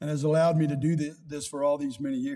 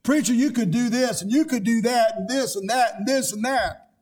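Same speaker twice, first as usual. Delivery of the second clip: higher, louder nitpicking member; nagging critic; 5 semitones higher, 3 dB louder than usual. Retold or imitated. Members criticising the preacher, nagging. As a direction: rattle off complaints at the preacher. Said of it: "Now they've got criticism".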